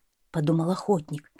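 ordinary delivery

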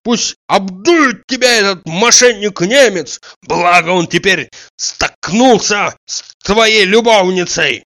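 high, loud, scornful rant; ranting yell. A narrator's reading. Tarantiev publicly shaming Oblomov, high scornful yelling. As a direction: keep yelling the abuse with relish. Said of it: "вопил Тарантьев".

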